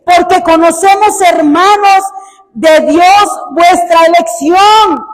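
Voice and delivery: booming pulpit scripture reading; preaching scripture reading. Acted. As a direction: proclaim the verses to the whole church. Reading scripture at full force.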